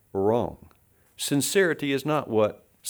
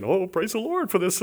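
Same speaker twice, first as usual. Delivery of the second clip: higher, mock-pious chirp; fake happy praise; 8 semitones higher than usual. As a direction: put on a phony happy face. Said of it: "put on this pretense that I'm always happy".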